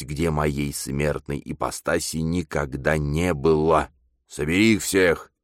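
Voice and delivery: grand possessed proclamation; prophesying demon. Acted. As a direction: command a mortal as an awakened demon.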